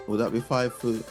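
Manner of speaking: ordinary talk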